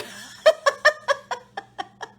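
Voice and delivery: a burst of high, loud laughter. Real, not acted. Laughing at her own swagger wagon joke, loud laugh. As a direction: crack up loudly.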